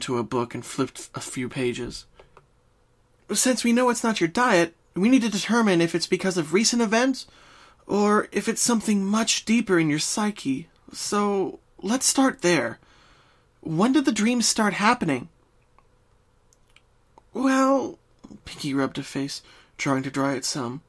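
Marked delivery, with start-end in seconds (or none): 3.3-15.3 higher, brisk studious pony; Twilight voice. Acted. Twilight questions Pinky to find the dreams' cause. 17.3-18.0 high, bouncy pony friend; Pinky voice. Acted. Pinky starts explaining her dreams.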